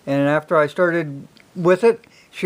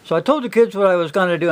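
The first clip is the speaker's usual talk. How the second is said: ordinary talk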